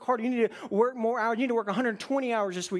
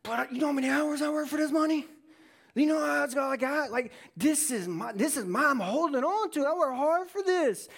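defensive protest; 4 semitones higher than usual. Acted. Person defends their money to God.

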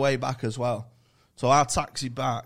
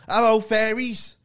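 cheery greeting; 11 semitones higher, 9 dB louder, slower than usual. Retold or imitated. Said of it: "and he goes".

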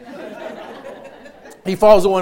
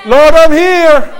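fervent praise; 6 semitones higher, 7 dB louder than usual. Acted. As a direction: call out to God in full praise. Voicing a worshipper's prayer.

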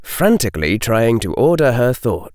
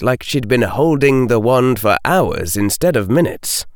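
usual speaking voice

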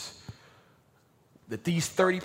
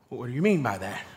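ordinary talk, nothing marked

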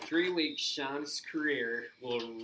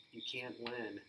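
soft, hushed and much quieter; 3 semitones lower, 9 dB quieter than usual. Retold or imitated. Shawn telling Triple H he can't win, hushed and quiet. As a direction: say it low and resigned, almost under the breath. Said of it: "and he says".

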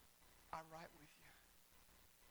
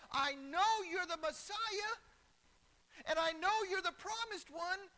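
high, loud pulpit proclamation; full-force preaching; 9 semitones higher, 11 dB louder than usual. Real, not acted. Preacher voices Martha declaring her faith to Jesus, emphatic.

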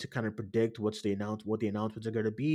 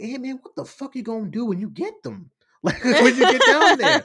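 high, loud, laughing disbelief at Ant-Man; laughing yell at a movie hero; excited yelling at the screen; 10 semitones higher, 11 dB louder than usual. Retold or imitated. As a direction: shout at the screen, cracking up. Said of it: "And I was like".

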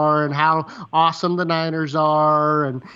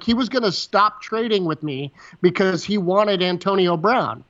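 normal talking voice

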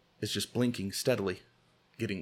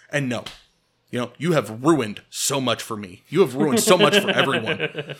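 loud, indignant accusation; hammed-up betrayed rant, hitting key words hard; acted outrage; 2 semitones higher, 7 dB louder than usual. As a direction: berate a friend for breaking his word. Acted quarrel over a broken promise.

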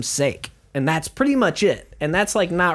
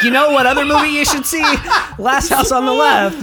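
high, loud, laughing mock suggestion; mock innocent recommendation; 9 semitones higher, 6 dB louder than usual. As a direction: pitch it up like a cheerful, clueless friend. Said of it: "and I was like".

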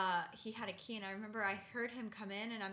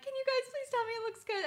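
pleading; 13 semitones higher, 4 dB louder than usual. Retold or imitated. Begging friends for reassurance.

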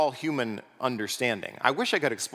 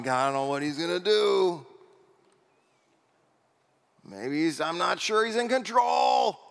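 mock anxious fretting; 6 semitones higher than usual. Anxious heavenly voice imagined.

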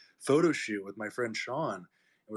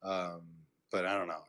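normal talking voice

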